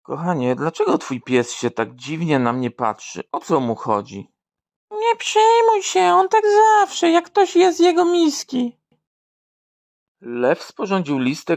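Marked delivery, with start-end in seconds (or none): 4.9-8.8 much higher, squeaky partner voice; high comic voice for the partner; high put-on voice. Joke dialogue, partner answers.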